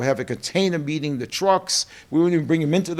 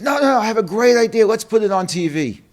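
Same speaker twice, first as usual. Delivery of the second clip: high, loud, fast eager father; eager dad; 8 semitones higher, 6 dB louder than usual. Retold or imitated. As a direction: burst in like a dad with a big idea. Father pitching his idea excitedly.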